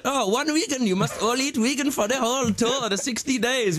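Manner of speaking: high put-on German accent; mock the strict German promoter in his accent. Musician mimicking German promoter demanding vegan tour, German accent. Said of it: "They'd be like".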